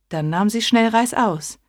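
ordinary delivery

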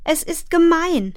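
put-on very high, upset child's voice; tearful protest. A narrator's reading. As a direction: wail it as an injured child's protest. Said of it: "schluchzte sie". (weinerlicher, hoher Protest)

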